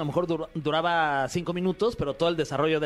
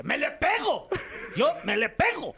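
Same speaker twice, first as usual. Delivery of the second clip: higher, laughing mock shout of the catchphrase; quoting the comedian's character; 10 semitones higher, slower than usual. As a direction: belt out the line as the comic did, cracking up. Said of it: "y que dice que".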